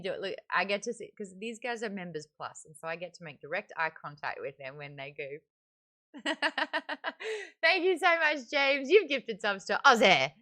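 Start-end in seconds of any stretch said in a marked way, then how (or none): 6.1-10.3 loud, high thanks bursting out of laughter; laughing thanks. Real, not acted. Thanking a viewer for gifted subs, laughing and loud.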